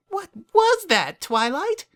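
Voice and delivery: a startled high little pony; bubbly Pinkie Pie. A narrator's reading. Pinkie Pie asking Twilight what happened, high startled voice.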